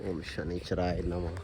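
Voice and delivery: slow, soft murmured prayer; murmured mantra. Real, not acted. Praying at the Shani idol, a slow soft murmured chant. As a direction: murmur the mantra slowly under your breath.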